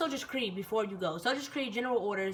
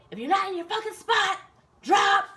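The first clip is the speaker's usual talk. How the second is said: high, loud barked order; sergeant's threat; 10 semitones higher, 7 dB louder, slower than usual. Retold or imitated. Sergeant to recruits out of place, loud high bark. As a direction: imitate a sergeant demanding pushups.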